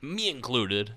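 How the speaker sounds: ordinary talk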